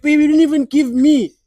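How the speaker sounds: put-on loud, high protest to a girlfriend; aggrieved outburst. Cheated boyfriend complaining to his girlfriend, loud aggrieved outburst.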